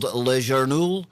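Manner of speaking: best attempt at French pronunciation; French accent. Shown as a demonstration. Host showing off to co-host the French newspaper's name, French accent. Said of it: "That's my best French accent".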